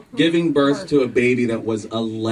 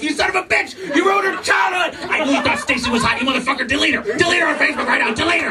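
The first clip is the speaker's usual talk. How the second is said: mock furious wife; 13 semitones higher, faster than usual. Wife yelling at husband over Stacy, hammed-up high screaming rant. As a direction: play the wife exploding at her husband, shrieking.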